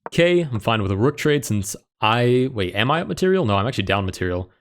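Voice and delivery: ordinary delivery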